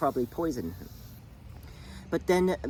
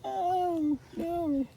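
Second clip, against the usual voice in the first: much higher, hammed-up wailing no; mock crying wail. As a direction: wail it like sobbing on the floor, overdone. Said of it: "she's on the floor crying".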